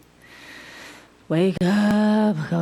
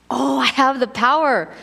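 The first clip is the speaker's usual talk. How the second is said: excited; 5 semitones higher, 4 dB louder than usual. Retold or imitated. Jesus realizing his power.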